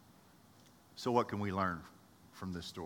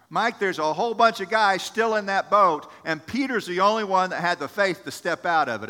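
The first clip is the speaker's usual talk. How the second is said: fervent preaching; 5 semitones higher, 8 dB louder than usual. Real, not acted. Preacher presses point.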